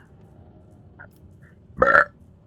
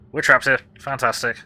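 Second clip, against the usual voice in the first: exasperated outburst; 3 semitones higher, 11 dB louder than usual. Real, not acted.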